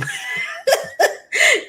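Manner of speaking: high, bursting laugh; laughing loud at the joke; big laugh. Real, not acted. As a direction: let a real laugh escape. Laughing at her own comment.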